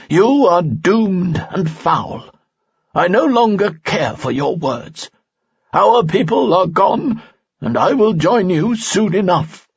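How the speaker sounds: put-on stern old sage. A narrator's reading. Falsafel's voice in story.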